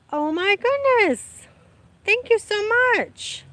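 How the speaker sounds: high, slow, delighted gasp of thanks; overjoyed, thanking a viewer for a super chat; excited thanks. Real, not acted. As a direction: gush with surprised gratitude.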